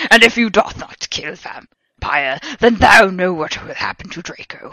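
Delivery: slow, loud, grandiose threat; shouting villain. A narrator's reading. Voldemort warning the heroine about Draco, slow shouted villain threat. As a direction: drag out the villain's warning loudly. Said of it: "he shouted".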